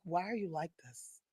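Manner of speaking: soft, mock-exasperated daughter; daughter quote. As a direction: quietly mimic a fed-up teen daughter. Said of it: "Alana's like".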